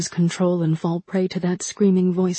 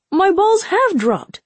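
much higher, slow, indignant squeak; indignant squeaky voice; 12 semitones higher, 4 dB louder, slower than usual. Acted. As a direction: squeak it out, offended. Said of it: "When your voice drops, then".

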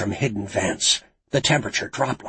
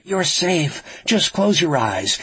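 higher, urgent reassurance; calling out; 7 semitones higher, slower than usual. A narrator's reading. Frankie tells Lucy she is safe, calling out. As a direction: call out to reassure her. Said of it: "Frankie called to her".

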